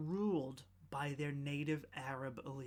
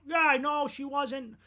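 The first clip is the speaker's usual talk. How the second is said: mocking gullible crowd; 9 semitones higher, 7 dB louder than usual. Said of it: "and people went".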